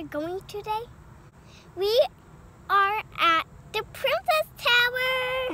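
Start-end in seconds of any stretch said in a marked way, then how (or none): 1.7-5.5 excited. Real, not acted. Kid announcing the place.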